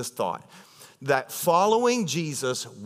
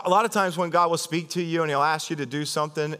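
ordinary delivery

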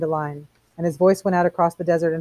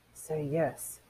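very soft, secretive murmur; hushed prompt; 11 dB quieter than usual. Acted. Faber feeding Montag his answer by earpiece, hushed. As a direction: prompt him under your breath.

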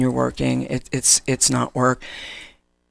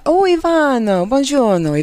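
hammy Italian greeting, high and loud; mock Italian, sung out; fake Italian accent; 10 semitones higher, 4 dB louder than usual. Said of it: "when I talk in my fake Italian".

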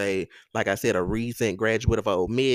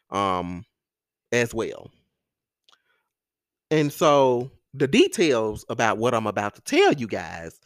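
normal talking voice

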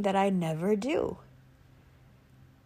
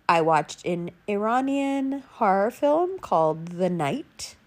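usual speaking voice

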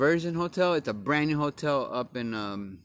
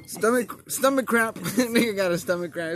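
laughing; 8 semitones higher, 4 dB louder than usual. Real, not acted. Laughing after slamming cold beer.

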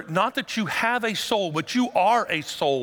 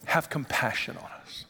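pleading whisper; 4 semitones lower, 5 dB quieter than usual. Retold or imitated. Desperate father pleading.

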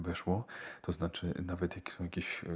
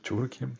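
"ciurkiem?" tried out with a high rising tune; tentative questioning rise; 7 semitones higher, 4 dB louder than usual. Real, not acted. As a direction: offer "ciurkiem?" as a hesitant high-pitched question. Testing an alternative word.

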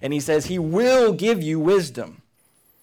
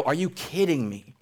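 mock annoyed God; 5 semitones higher than usual. Preacher voicing an imagined irritated God to the congregation, mock annoyed. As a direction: play an irritated God fed up with being asked again. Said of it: "God doesn't sit up there and go".

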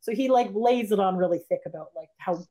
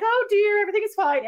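mock soothing husband; 12 semitones higher, 3 dB louder than usual. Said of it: "her husband's like".

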